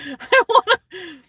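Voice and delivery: high, loud burst of laughter through the words; laughing. Real, not acted. Laughing about breaking into his house, loud and high. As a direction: laugh it out, slowed and squealing.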